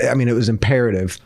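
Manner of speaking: ordinary delivery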